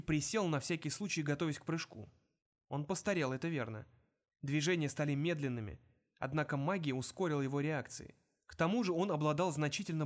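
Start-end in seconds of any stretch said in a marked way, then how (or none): none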